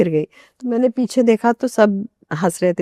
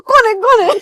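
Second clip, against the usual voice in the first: high, loud, half-laughing cry of a startled aunt; 12 semitones higher, 5 dB louder than usual. Retold or imitated. Aunt wakes as someone falls on her, high startled cry. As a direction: squeal it like an aunt woken in the dark. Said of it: "दूसरी aunty बोलती".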